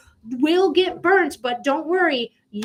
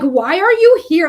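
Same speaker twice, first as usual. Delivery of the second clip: a loud, exasperated "Why are you here?"; frustrated outburst; 3 semitones higher, 9 dB louder than usual. Retold or imitated. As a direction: toss it at an unwanted ex, fed up. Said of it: "that's a frustration".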